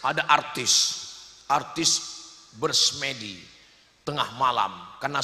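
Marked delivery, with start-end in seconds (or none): none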